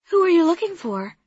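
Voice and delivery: put-on young woman voice. Soraya voiced.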